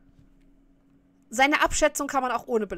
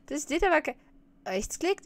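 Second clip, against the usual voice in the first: usual speaking voice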